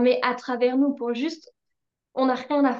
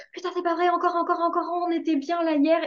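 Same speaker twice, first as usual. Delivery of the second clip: exasperated; 6 semitones higher, faster than usual. Retold or imitated.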